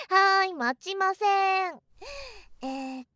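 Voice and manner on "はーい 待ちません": slow, loud, teasing sing-song. Real, not acted. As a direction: tease him, drawn out. Teasing refusal.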